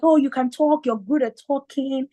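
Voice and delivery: high-pitched imitation of coaxing; encouraging dad. Dad persuading daughter to study law, encouraging. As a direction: talk her into it as dad.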